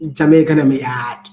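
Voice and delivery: loud try at Jamaican patois; attempted Jamaican accent. Shown as a demonstration. Speaker tells partner to say it, attempted Jamaican accent.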